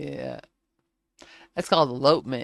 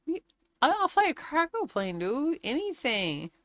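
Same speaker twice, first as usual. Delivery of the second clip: high, pleading bargaining voice; 11 semitones higher than usual. Retold or imitated. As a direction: beg for any job at all. Begging the Navy to let her fly.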